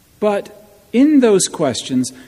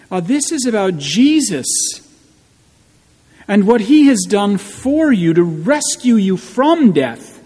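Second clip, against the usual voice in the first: rising, declaiming preacher's voice; full preaching; 4 semitones higher, 4 dB louder than usual. Acted. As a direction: proclaim it like the voice of All Saints. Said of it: "what All Saints does is it says".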